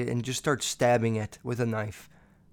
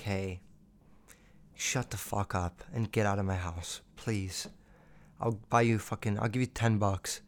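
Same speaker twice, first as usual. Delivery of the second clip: much softer, flat and low, dead calm; deadpan brush-off; 6 semitones lower, 8 dB quieter than usual. Pretend. Telling the clingy girl to get out, soft and deadpan. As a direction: tell her to leave like you're bored.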